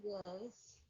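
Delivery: a barely voiced yes, almost whispered; muffled and low, hard to hear; very quiet. Real, not acted. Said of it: "you get real low".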